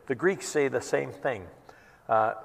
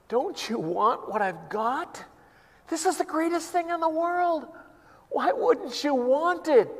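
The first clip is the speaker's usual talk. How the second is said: Paul pleading, much higher, almost in tears; Paul crying out; 13 semitones higher than usual. Retold or imitated. Paul begging people to hear the gospel, tearful high plea. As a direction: beg them like your heart is breaking. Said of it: "coming up to them crying".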